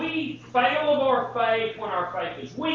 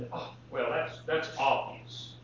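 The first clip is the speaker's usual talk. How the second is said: dismissive aside; 7 semitones lower, 7 dB quieter than usual. Pretend. Voicing a dismissive listener.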